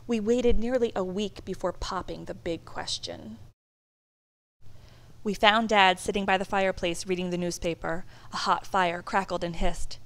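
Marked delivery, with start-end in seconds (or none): none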